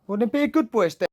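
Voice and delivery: loud scolding mum. Real, not acted. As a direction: raise your voice and scold.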